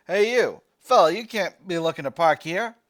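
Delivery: a New Jersey sergeant hollering at a tank crew; Jersey accent. Acted. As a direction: shout it across the yard in a rough Jersey accent. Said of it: "The crimp of his Jersey accent".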